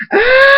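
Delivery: long, piercingly high scream; loud shriek of pain. A narrator's reading. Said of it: "Zapapple screams".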